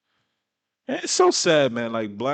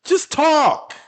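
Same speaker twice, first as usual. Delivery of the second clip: higher and louder, real disbelief; exasperated outburst; 13 semitones higher, 5 dB louder than usual. Real, not acted. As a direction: throw up your hands at this guy. Reacting to a repetitive speaker.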